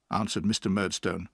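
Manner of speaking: ordinary delivery, nothing marked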